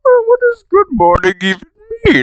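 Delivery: very high and quick, goofy; squeaky confused wizard; high silly Gandalf voice. Retold or imitated. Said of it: "Gandalf is like".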